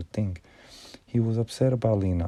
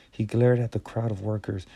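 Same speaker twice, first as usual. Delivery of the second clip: ordinary delivery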